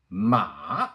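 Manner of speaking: MA dipping down, then running back up; long dip-and-rise MA; third tone. Shown as a demonstration. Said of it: "Let's review one more time".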